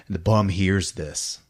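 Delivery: ordinary talk, nothing marked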